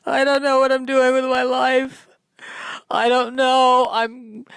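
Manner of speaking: mock sobbing plea as a bird call; crying mating call. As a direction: wail for love, pitched high. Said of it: "walk up to a woman and go".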